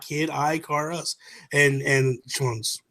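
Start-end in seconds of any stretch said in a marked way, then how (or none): 0.0-1.2 put-on "Kid Icarus" sounded out slowly, EYE-ka-rus; slow careful "Kid Icarus", long I; sounding out words. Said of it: "I just sounded it out".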